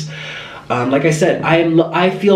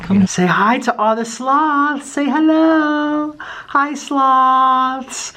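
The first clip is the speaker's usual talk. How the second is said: high singsong greeting; cutesy pet talk; 6 semitones higher, much slower than usual. Real, not acted. Owner coaxes kitten to greet the sloths, cutesy. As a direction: stretch it out high and sweet.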